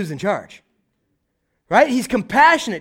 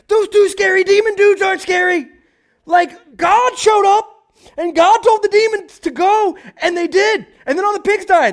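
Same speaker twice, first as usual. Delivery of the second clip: much higher, louder, squeaky telling; breathless gossip; 7 dB louder than usual. Retold or imitated. Herdsmen gushing to townsfolk about the demon men, high and squeaky. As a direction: gush the news like town gossip.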